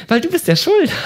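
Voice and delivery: high-pitched mocking blame, pointing the finger; mock accusation. Speaker mimics someone blaming their partner, high mocking tone. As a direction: squeak it out like a childish accusation.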